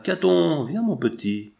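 calling a dog over, name pitched high. A narrator's reading. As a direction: call the little dog to come. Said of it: "Il appela".

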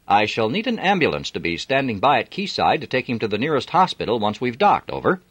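captain asking the harbour for an ambulance; captain reporting emergency. A narrator's reading. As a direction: report the accident with brisk authority.

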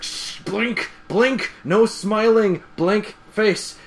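high, slow, urgent hushing orders; Rainbow Dash. Retold or imitated. Rainbow Dash correcting Pinkie, high insistent mock orders. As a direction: punch each word as a mock command. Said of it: "Rainbow Dash is like".

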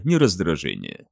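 ordinary delivery

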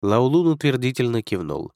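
ordinary delivery

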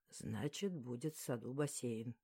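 low male voice; male lead's promise. A narrator's reading. He promises her a pool in the garden, low male voice.

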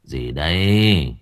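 slow, low, slurred mumble; slurred drowsy mumble. A narrator's reading. Exhausted Tâm mumbling at the gate, slow and slurred. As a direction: drag the words out half asleep. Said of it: "lè nhà lè nhè".

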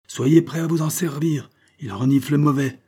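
a touch of a commanding skipper; lightly voiced captain. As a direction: alert the crew with firm projection. Captain warns his armed men.

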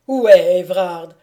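loud, alarmed outburst; shuddering question. A narrator's reading. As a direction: demand it loudly, shaken. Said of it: "dit Maximilien en frémissant".

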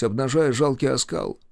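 ordinary delivery, nothing marked

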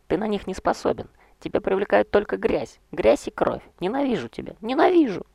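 high furious hysterical shriek; shrieking woman. Acted. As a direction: scream your hatred at him. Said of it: "вскрикнула".

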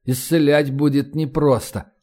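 plain reading voice

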